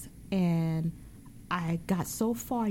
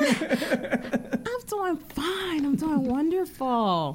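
playful laughing reply; 7 semitones higher, much slower than usual. Pretend. Guest plays along with host.

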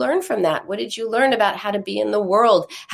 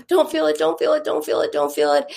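higher, urgent chant to oneself; anxious self-talk; 6 semitones higher, faster than usual. Retold or imitated. Grown girl tells herself not to feel sad, anxious. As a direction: act out frantic suppressing of a feeling.

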